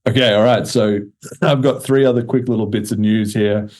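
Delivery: ordinary talk, nothing marked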